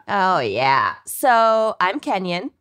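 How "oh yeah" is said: very low, drawn Minnesotan "Oh, yeah"; deep, dragging Minnesotan accent. Pretend. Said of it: "unleash their worst Minnesotan accents".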